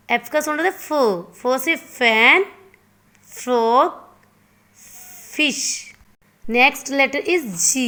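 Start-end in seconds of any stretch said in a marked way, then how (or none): none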